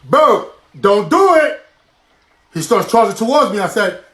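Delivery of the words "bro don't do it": slow, high, spaced-out warning; comic warning. Acted. Storyteller facing down an elephant in safari, comic slow warning. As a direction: draw out each word, pitched up. Warns animal about to charge.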